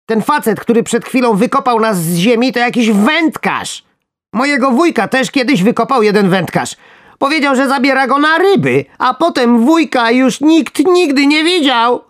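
very high, loud, frantic squeaking worm; breathless tale of the vanished uncle. Acted. Worm desperately warns the others about the fisherman.

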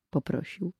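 usual speaking voice

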